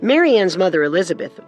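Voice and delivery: ordinary talk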